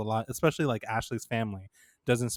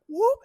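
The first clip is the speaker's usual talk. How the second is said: quick high whoop, louder; imitated whoop; 6 dB louder than usual. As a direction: pop out a mock reveal noise. Imitates grandpa flashing the robe.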